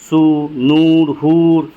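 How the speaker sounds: long, held 'oo' in सू, नूर, हूर; drawn-out vowel. Shown as a demonstration. Teacher showing the class the long waaw, vowels drawn out. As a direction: stretch the vowel of सू, नूर, हूर, slow and steady. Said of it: "जो खींचकर पढ़ी जाती है".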